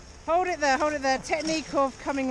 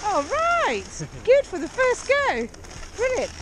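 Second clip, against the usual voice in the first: cheery praise; 8 semitones higher than usual. Real, not acted. Student's first go succeeds.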